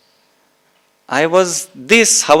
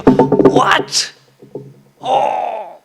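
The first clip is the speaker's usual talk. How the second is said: breathless whisper breaking into a cry; whispered realization; 4 semitones higher than usual. Acted. A listener who truly gets it, in whispered astonishment. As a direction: gasp it out, then cry out.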